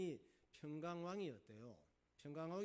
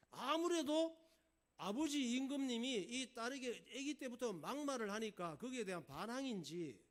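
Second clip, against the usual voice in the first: ordinary delivery, nothing marked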